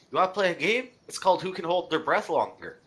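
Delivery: mock-cheery threat. Freak grinning at the bandit, menacingly playful. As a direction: sound gleeful while threatening. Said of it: "Freak is asking the lesser guy".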